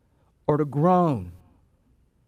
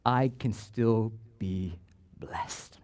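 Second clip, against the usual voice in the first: hushed; 8 semitones lower, 6 dB quieter, much slower than usual. Real, not acted. Quiet emphatic sermon climax.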